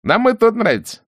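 high-pitched cheeky soldier; mocking refusal. A narrator's reading. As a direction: tease her with a high voice. (насмешливо, высоким голосом)

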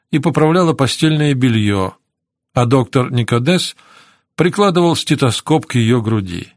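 ordinary delivery

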